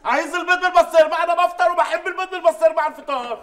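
loud, high furious demand; angry shouting. Acted. Adel shouts at the maid and his mother for his eggs with pastirma, furious. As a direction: yell it like a spoiled man who wants his eggs.

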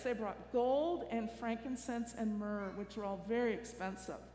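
normal talking voice

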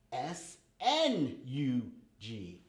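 S N U G sounded out letter by letter, slowly; spelling out letters. Shown as a demonstration. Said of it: "Can you find a word that has the short U sound?".

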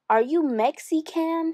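high, slow, wide-eyed question; startled question. Acted. Howard stops wrestling, asks in high, slow surprise. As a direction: ask it high and slow, stunned. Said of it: "he said".

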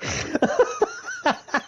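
a very high, soft squeal of laughter. Real, not acted. Laughing at her own story, a very high squeal. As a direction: let out a tiny high squeal.

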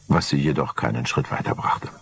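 ordinary delivery

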